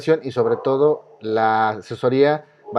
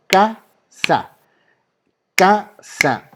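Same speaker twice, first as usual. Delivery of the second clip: CA - SA, two separated beats, higher pitched; syllable by syllable; 6 semitones higher, 3 dB louder, much slower than usual. Shown as a demonstration. Teacher has students listen for the stressed syllable, syllable by syllable. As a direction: chant "Casa" as two clapped-out syllables. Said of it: "como cuando los ponían a palmear en la primaria".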